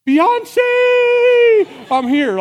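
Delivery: very high, loud fan shriek; screaming fan. Pretend. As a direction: scream for her attention over the crowd.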